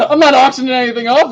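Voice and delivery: words in a real laugh. Real, not acted. Streamer laughing at fake bids, laughing. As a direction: crack up on the words.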